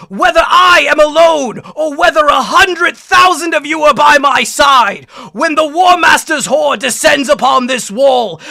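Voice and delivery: loud, slow, shouted battle speech; defiant commander yelling a rallying speech; yelled war speech. Acted. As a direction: hold the wall and roar it out to the troops. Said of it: "let me see if I can do something with it".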